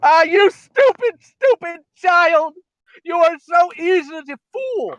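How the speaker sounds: high, slow gloating villain voice with a cracking voice; wicked stepmother. Acted. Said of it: "Did a thirty-five-year-old's voice just crack?".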